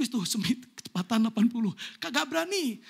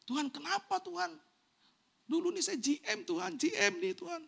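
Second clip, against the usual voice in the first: complaining to God; 6 semitones higher, 4 dB quieter than usual. Retold or imitated. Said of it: "saya komplain dengan Tuhan".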